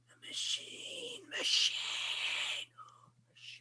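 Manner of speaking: eerie whispered monster rasp, fading away; creature voice. Acted. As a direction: breathe it out like a flesh creature. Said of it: "It runs part of its body off the desk and says".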